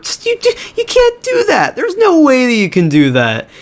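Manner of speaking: mock outrage in a very high squeak; squeaky protest. T L O objecting to the evidence, squeaky mock outrage. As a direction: stammer into a squeal, then come down.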